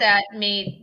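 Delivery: usual speaking voice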